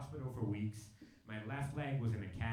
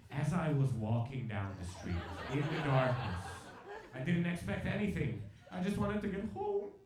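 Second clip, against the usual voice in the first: mock dramatic; 3 dB louder than usual. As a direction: build a tearful-sounding tale for effect, louder on key words. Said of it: "trying to get this dramatic story out of me".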